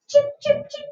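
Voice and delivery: high, thin chirpy cheep cheep cheep; chick cheeping. Retold or imitated. Teacher imitating chicks for the class, high chirpy cheeps. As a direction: peep like a tiny newborn chick.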